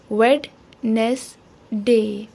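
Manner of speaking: Wednesday in three separate beats, "wed - nes - day"; spelling pronunciation. Shown as a demonstration. Teacher helps children spell it, Wednesday broken as wed, nes, day. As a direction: say Wednesday as written, the D sounded, pausing between syllables. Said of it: "इसको यूँ लिखा जाएगा".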